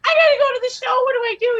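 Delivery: high, squeaky mock panic; panicked. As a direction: play it like a frightened little voice. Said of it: "I'm like".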